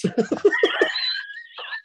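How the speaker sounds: high helpless burst of laughter; big real laugh. Real, not acted. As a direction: lose it completely, laughing high. Cracks up at own story.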